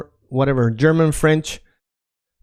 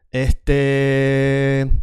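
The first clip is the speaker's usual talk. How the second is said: "Este" with a long drawn-out final e, held level; thinking filler. Shown as a demonstration. Latin American speaker thinking, drawn-out hesitation. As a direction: stretch the last vowel of "Este" as you stall. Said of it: "a lot of people, when they are thinking".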